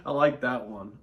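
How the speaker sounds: ordinary talk, nothing marked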